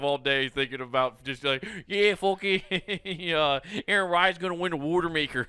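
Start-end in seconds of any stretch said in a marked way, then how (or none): 1.9-5.5 higher, fast Philly fan rambling; lightly hammed Philly bro, fast and eager; Philly accent fan boast; mock Philly accent. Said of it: "dirty Philly accent".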